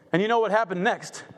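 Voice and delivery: ordinary talk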